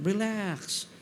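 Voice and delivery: relax said softly and falling. Shown as a demonstration. Pastor to church, softly modelling the word relax. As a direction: ease the word out gently. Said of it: "gusto ng Panginoon".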